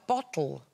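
"bottle" with a glottal stop; "bottle" with the T swallowed; British "bottle". Shown as a demonstration. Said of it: "She had a kind of".

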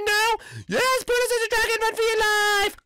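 high, fast frantic yelling; panicked shouting. Pretend. Warning the friend about the dragon, fast high yells.

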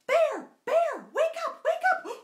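a little rabbit shaking Bear awake; excited rabbit. Acted. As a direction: Rabbit calls to sleepy Bear, high and excited. Rabbit wakes sleepy Bear.

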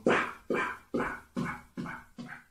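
soft voiceless pa pa pa; whispered pa sounds. Shown as a demonstration. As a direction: puff out pa like beatbox. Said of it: "Ok, repito. Primero así".

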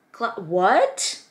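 high, loud rising what; astonished. Real, not acted. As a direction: stumble into a loud, disbelieving what. Astonished by planner feature.

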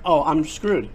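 usual speaking voice

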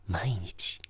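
creepy whisper in her ear. Acted. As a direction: breathe the word close and secret. Whispered stalker detail.

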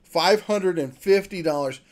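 ordinary talk, nothing marked

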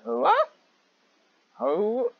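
normal talking voice

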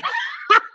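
high, breathless burst of laughter; helpless laugh. Real, not acted. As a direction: laugh so hard it snorts. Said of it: "I'm laughing so hard, I snorted".

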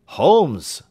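a sudden high, loud cry of the name. Acted. Watson realising the danger, crying out to Holmes. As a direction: call his name out as it dawns on you. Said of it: "I cried".

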